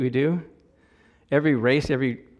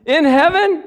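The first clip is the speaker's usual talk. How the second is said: amazed rhetorical question; 12 semitones higher, 8 dB louder, much slower than usual. Real, not acted. Rhetorical astonishment.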